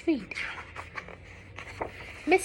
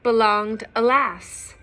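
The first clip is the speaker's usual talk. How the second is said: lilting storybook read-aloud; reading to children; 5 dB louder than usual. A narrator's reading. Parent reading a picture book to children, lilting. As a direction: read the rhyme aloud to little ones.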